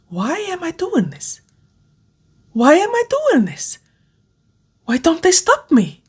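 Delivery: high, strained, cracking with terror; voice breaking in panic. A narrator's reading. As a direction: cry it out as she realizes too late. Final crash, voice breaks.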